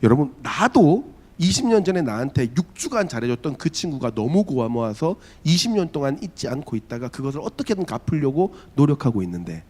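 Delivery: ordinary talk, nothing marked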